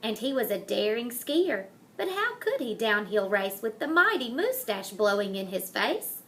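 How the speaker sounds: plain reading voice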